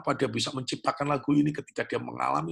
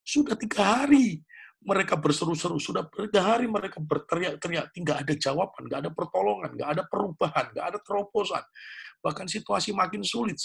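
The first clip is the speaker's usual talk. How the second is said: preaching at full force; 8 semitones higher than usual. Acted. Pastor to the congregation about Israel's thirst, fervent preaching. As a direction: drive the congregation with rising fervour.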